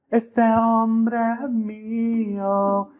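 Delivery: high, slow, drawn-out mock mother; possessive mother. Mother claiming her son before the Lord, high and drawn out. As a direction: stretch it out like a clingy mom claiming her boy.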